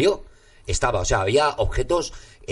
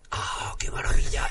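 whispered delight; whispering; 3 dB quieter than usual. Real, not acted. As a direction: breathe it out in an awed whisper. Delighted reaction to the raised finger.

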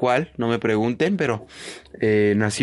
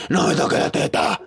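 whispery imitation of an aging host; lightly voiced old woman, breathy; older woman's voice. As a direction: rasp it out like an old lady. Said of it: "como habla ella con una voz ya más vieja".

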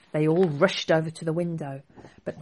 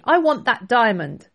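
loud, higher demanding yell; angry shout; 6 semitones higher, 8 dB louder than usual. Acted. Prince yelling after the thief, angry shout. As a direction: bellow it at the thief. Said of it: "the prince shouted angrily".

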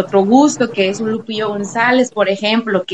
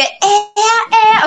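signature radio shout; 11 semitones higher than usual. Shown as a demonstration. Host shows the shout she opens her programme with, high signature cry. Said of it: "Yo tengo un grito que a mí me identifica".

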